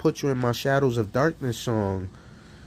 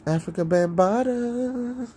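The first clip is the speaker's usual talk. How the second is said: high slow name call; drawn out high name call; mock announcement; 10 semitones higher than usual. Mocking rapper name-drop.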